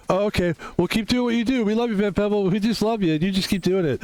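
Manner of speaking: play-acted high fan voice; adoring fan. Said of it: "this is your fan again".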